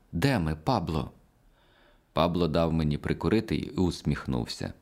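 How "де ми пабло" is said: startled, mildly alarmed question; frightened exclamation. A narrator's reading. Narrator asking Pablo where they are, mildly frightened. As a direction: ask it with a touch of fright. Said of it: "вигукнув я злякано".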